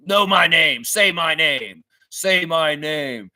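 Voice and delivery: slow, menacing Say my name, lightly done; a hint of a drug kingpin demanding respect; Heisenberg impression. Streamer joking about star players, slow mock menace. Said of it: "uh, Breaking Bad".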